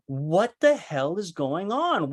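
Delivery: acted indignant bafflement; retold incredulity. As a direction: draw it out in disbelief. Retold disbelief at the clinging child.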